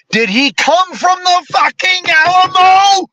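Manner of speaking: rising rant peak in disbelief; incredulous rhetorical peak. Real, not acted. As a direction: hammer the absurd question home. Rhetorical Alamo question, building.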